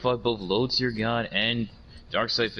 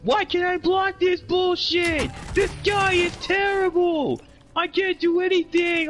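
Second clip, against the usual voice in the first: high, slow whining complaint; whining loser; 3 dB louder, slower than usual. Retold or imitated. Mocking the beaten opponent raging, high and whiny. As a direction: whine like a sore loser raging at the screen. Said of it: "all of a sudden you're hearing".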